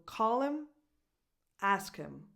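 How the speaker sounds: "call-im" lifted, "ask-im" falling; dropped H. Shown as a demonstration. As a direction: stress call and ask, each "him" just "im". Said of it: "No H sounds".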